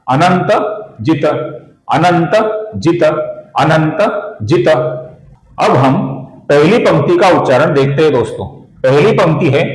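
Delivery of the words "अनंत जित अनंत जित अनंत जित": अनंत जित with the last त almost fully said, words split by pauses; final त demo. Shown as a demonstration. Teacher drilling the final त of अनंत जित for viewers. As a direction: repeat अनंत जित slowly, nearly full final त. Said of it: "हम लगभग पूरा उच्चारण करेंगे, अर्थात".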